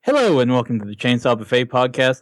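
normal talking voice

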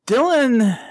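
ordinary talk

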